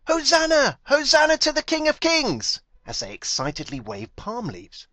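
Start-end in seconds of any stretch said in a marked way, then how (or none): 0.1-2.6 high, loud jubilant shout; crowd shouting. A narrator's reading. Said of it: "They were shouting".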